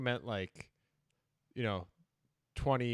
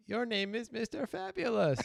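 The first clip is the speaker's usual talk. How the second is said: high, slow mock child voice; imitating a kid; 10 semitones higher than usual. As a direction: lightly play the little kid. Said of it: "his kid was like".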